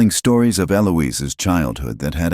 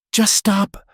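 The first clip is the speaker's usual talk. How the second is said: a high, raised cry to halt it; strong; 4 dB louder than usual. A narrator's reading. Abigail to Miriam, cutting her off with a cry. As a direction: force it out stronger than you feel. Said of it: "voice came out stronger".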